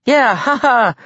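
high, over-eager fake laughing; forced laugh. A narrator's reading. Sam joining the act for the giants, forced laugh. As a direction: play along with a put-on laugh. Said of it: "tried to get into the act".

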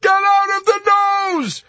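very high, loud shriek; frustrated shriek. A narrator's reading. Yossarian to Arfi who will not hear, frustrated shriek. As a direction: shriek the order in exasperation. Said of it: "Yossarian shrieked in frustration".

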